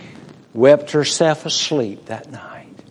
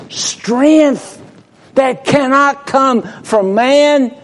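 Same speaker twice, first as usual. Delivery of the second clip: higher, louder ringing preaching; climactic preaching; 11 semitones higher, 4 dB louder, slower than usual. Acted. Preacher proclaims God's strength to congregation, soaring. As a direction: lift the word strength up high.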